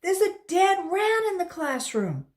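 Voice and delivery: loud, high call across the room; a boy calling out to his teacher. Acted. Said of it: "he called".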